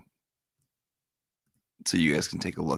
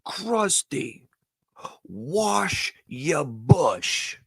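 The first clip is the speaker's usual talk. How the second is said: high, drawn-out call from the crowd; slow, high-pitched heckle from the audience; eager fan heckling; 7 semitones higher, much slower than usual. Retold or imitated. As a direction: pitch it up and stretch every word like a fan shouting at the stage. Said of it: "and she goes".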